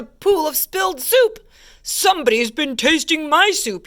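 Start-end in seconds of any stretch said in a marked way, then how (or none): 1.8-3.9 indignant storybook roar, mildly done; a touch of a bear's roar; roaring papa bear. A narrator's reading. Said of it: "he roared".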